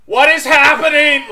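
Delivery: loud, high, laughing shout. Retold or imitated. Loud high laughing shout at the concert. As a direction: yell out in total confusion. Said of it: "Like, very loudly".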